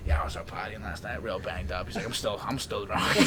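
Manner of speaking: lightly voiced worn-out drinker; Ryan impression. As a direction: imitate a buddy still drunk from last night. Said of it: "he'd be like".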